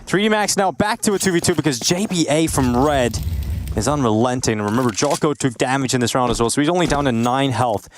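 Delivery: excited play-by-play. Acted. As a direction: build the clutch for the crowd.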